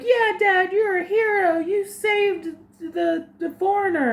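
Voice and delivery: high, excited kid voice, a little hammed-up; mock child cheering on his father; imitating an excited little kid. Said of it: "And the kids are like".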